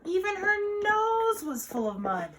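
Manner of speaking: high, loud, animated narration; excited storytime climax; exaggerated storytelling. A narrator's reading. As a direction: play up the mess for little listeners. Comic climax for young listeners.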